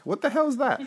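high, exclaimed mock disbelief; retold bewilderment. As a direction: rise sharply into a baffled outburst. Acting out his bewilderment.

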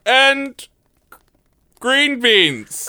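high, quavering heroic knight; hammy stage actor. Acted. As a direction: declaim it like a stage hero. Knight announces himself.

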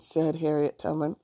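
ordinary delivery, nothing marked

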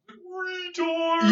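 very high, loud, far-carrying holler; call from mountain. Retold or imitated. Mimicking New Zealanders heard from the mountain, hollering. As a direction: imitate a yell from a mountaintop. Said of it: "you hear it from, like, the mountain".

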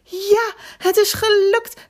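high, jubilant cry of success; exultant yell; loud triumphant shout. A narrator's reading. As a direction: cheer out loud, thrilled it worked. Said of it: "riep hij hardop".

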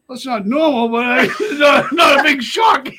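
high, loud, laughing protest; laughing excuse. Retold or imitated. Man excusing his father to counselor, laughing loudly. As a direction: blurt it high, breaking into laughs.

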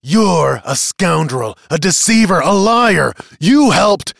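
high, loud, mounting tirade; furious accusation. Acted. Accuser jabs the witness in the chest, furious.

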